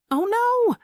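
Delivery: sudden high, loud cry of alarm. Acted. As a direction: shriek it out in dismay. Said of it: "Millicent cries".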